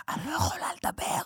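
unvoiced whisper, high; whispering mother. Mom whispering on the phone to her son. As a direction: whisper it like mom hiding a call. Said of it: "אומרת לי".

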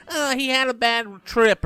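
high, slow mock-pitying voice; mocking sympathy. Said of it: "this garbage where we say".

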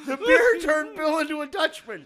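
high, loud crying laughter; laughing. Real, not acted. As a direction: laugh until near tears. Laughing to tears.

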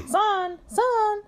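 high, drawn-out call; singsong storybook calling; pig calling out. A narrator's reading. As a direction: call down a well for someone. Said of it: "said Pig".

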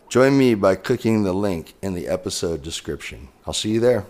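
loud, upbeat ad read. Acted. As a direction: pitch it like a sponsor spot. Sponsor ad read for Centered.

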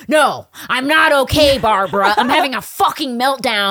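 loud, high, slow exasperated cry; retold meltdown outburst. Panicking passenger snapping at the flight attendant, loud exasperated cry. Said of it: "And I was like".

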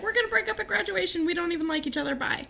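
high, fast, breezy dismissal; mocking Holly J. Holly J brushing off Sav, high and fast. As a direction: toss it off lightly. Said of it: "being like".

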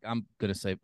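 deadpan. Retold or imitated. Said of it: "He was so serious, like deadpan".

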